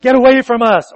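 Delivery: sharp loud demon cry; demon's loud cry. Acted. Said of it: "he cried out with a loud voice".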